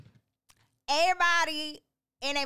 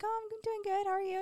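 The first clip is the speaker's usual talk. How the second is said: fake sweet; 12 semitones higher, 4 dB quieter, faster than usual. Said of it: "I was like".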